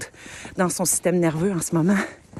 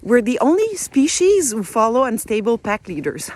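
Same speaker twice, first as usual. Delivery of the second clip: quoting in English; 2 semitones higher than usual. Retold or imitated. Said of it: "il disait".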